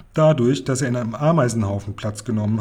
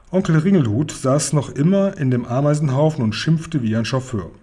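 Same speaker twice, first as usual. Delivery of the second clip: plain reading voice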